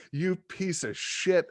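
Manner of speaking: acted indignant outrage; retold cussing. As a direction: curse him out slowly, as a retelling. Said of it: "I was so pissed".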